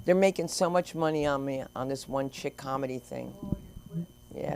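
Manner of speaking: ordinary talk, nothing marked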